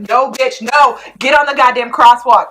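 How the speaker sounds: loud, exasperated mock telling-off; retold scolding yell. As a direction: shout it at a careless character on screen. Retells yelling at the character.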